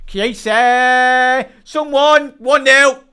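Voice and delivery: real jubilant goal yell; goal celebration shout. Real, not acted. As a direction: roar the goal out to chat.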